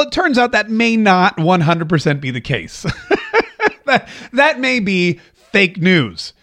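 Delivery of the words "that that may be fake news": real chuckle breaking into the words; laughing. Real, not acted. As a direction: laugh as you concede the point. Amused by his own admission.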